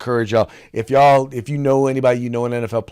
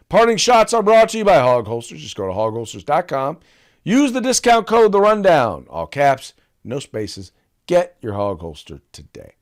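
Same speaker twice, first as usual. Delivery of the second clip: ad read; 3 semitones higher, 5 dB louder than usual. Acted. Host plugs the sponsor to listeners, ad read voice. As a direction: sell the sponsor like a radio spot.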